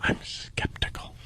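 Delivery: hushed whispered aside; whispering. Real, not acted. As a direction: whisper it so the caller can't hear. Aside while call is live.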